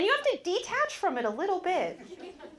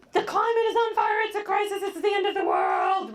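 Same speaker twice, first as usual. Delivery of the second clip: hammed-up alarm, higher and louder; mock alarm; 5 semitones higher, 3 dB louder than usual. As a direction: play the panicked activist, overblown. Mocking alarmist messaging.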